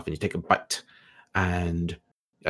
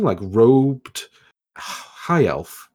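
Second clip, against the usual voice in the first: ordinary talk, nothing marked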